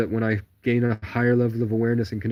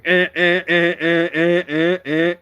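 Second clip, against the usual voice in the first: high, loud repeated bird cry like a siren; siren-like bird squawk; imitating a bird; 7 semitones higher, 7 dB louder, slower than usual. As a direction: chirp like a siren-sounding bird. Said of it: "a bird started going".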